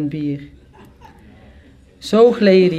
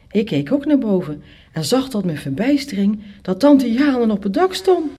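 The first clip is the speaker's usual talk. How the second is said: usual speaking voice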